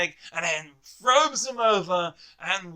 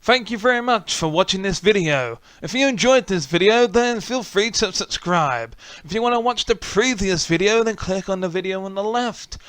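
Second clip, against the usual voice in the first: YouTube outro; 6 dB louder than usual. Acted. Channel outro to viewers.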